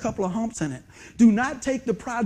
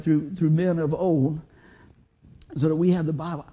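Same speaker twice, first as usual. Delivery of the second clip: ordinary delivery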